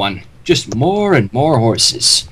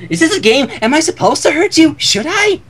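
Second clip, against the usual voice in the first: high, bouncy, eager pony voice; bubbly Pinkie Pie; 11 semitones higher than usual. Acted. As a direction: squeak it out, thrilled to play a game. Pinkie Pie line redone.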